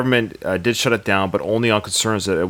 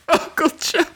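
fake wailing. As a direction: wail it like a paid mourner. Hammed-up fake mourner wailing.

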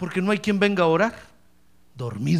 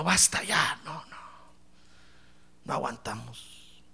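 mock whisper; 7 semitones lower, 9 dB quieter than usual. Imita creyentes susurrando.

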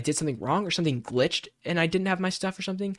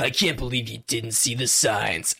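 slow, lower sneering scold; lightly voiced contemptuous scold from a sidekick; mocking Johnny Silverhand; 5 semitones lower than usual. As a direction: talk down to him like a jaded rocker. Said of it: "he's like".

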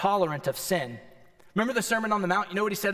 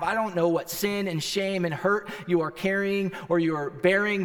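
ordinary talk

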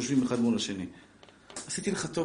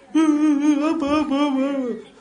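high, very slow, louder mock wail of a child; kid wailing for dad; 11 semitones higher, 7 dB louder, much slower than usual. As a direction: stretch out a hammed-up cry for daddy. Beaten little brother calls dad. (יללת ילד מוגזמת, איטית וגבוהה: "אבא")

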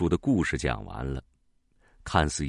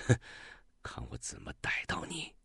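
sly whisper; 6 semitones lower, 12 dB quieter, faster than usual. Acted. Hunter hiding in ambush.

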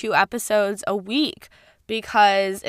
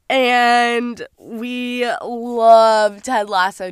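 long stretched words, a little louder; drawn-out gush; 3 semitones higher, 6 dB louder, much slower than usual. Real, not acted. Host gushing to listeners about Ted Lasso, slow and stretched. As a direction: savor every word of it.

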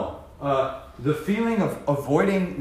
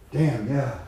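ordinary delivery

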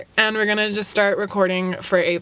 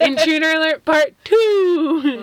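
slow, high, loud announcer alarm; alarm shout; 8 semitones higher, 4 dB louder, slower than usual. Pretend. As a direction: call it out like a siren. Door opens again.

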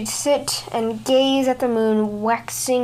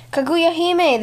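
calling out; 7 semitones higher than usual. A narrator's reading. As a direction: call after her as she leaves. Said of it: "they cried".

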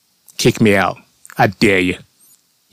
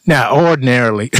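normal talking voice

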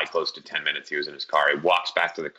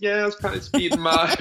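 laughing; 14 semitones higher than usual. Retold or imitated. Sheepish confession to mom.

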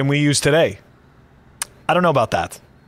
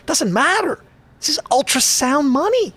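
mocking; 10 semitones higher than usual. Mocking the marketing line.

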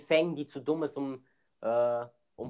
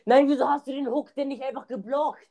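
exasperated outburst; 10 semitones higher, 5 dB louder than usual. Real, not acted. Teammate failed to block hook.

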